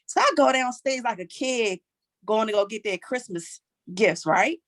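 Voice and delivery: usual speaking voice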